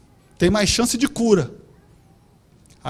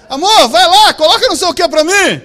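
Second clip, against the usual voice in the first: higher, louder demanding wife; imitating the wife; 7 semitones higher, 10 dB louder than usual. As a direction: call out to him like a bossy wife. Wife voiced asking husband.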